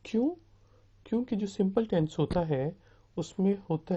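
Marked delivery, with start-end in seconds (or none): none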